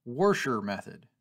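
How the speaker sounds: "Warscher" with an added R sound; "Warscher", an R slipped into washer; extra R. Shown as a demonstration. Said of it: "with an extra R in the middle".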